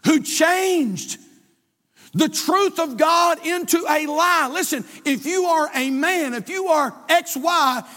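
fiery pulpit reading; preaching. Acted. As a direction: preach it out high and loud.

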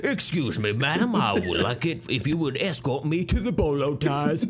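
hammed-up Southern gentleman accent; courtly Southern accent asking a lady for help. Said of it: "so Southern drawl".

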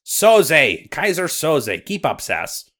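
Scottish correction, stressed Soze; Scottish accent. Acted. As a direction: correct him in a Scottish lilt.

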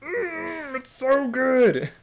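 high, slow, swooning delight; savoring. Retold or imitated. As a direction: melt over how good it is. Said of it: "I'm just like".

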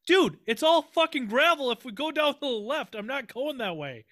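high, loud exasperated outburst; exasperated shout. Retold or imitated. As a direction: blow up at your buddy. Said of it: "I'm like".

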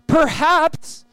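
high, drawn-out, halting question; preacher dramatically voicing a captain's appeal; desperate hope. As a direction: stretch the first word as a tentative plea.

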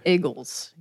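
"Eagles" as "iggles"; Philly "Eagles". Shown as a demonstration. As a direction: say "Eagles" with a short i. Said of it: "they say Eagles instead of Eagles".

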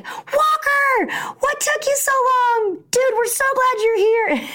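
put-on high excited boys' welcome; excited kids. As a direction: cheer like eager boys. Buddies greet him excitedly.

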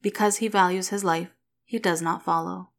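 usual speaking voice

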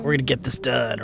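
a calm stoic soldier; stoic hero. Retold or imitated. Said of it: "your stoic character".

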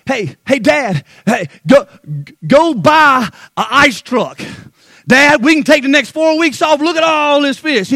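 Peter hollering to Dad, hammed-up; mock yelling. Peter bragging to his dad about the catch, mock shout. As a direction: call out over the water, half in jest. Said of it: "you don't see Peter yelling back".

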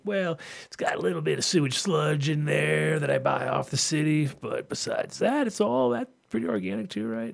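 high, put-on voice of a smooth seller; mock salesman. Mock seller voice in retold exchange.

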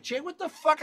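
high, stunned what-the-fuck; shocked outburst. Retold or imitated. Shoved into a room, yelling at Jay in shock. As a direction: blurt it out in disbelief.

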